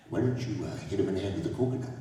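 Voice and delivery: low, soft suggestion in character; Chief Jay Strongbow. Retold or imitated. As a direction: imitate a chief quietly pitching an idea. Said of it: "and he said to me".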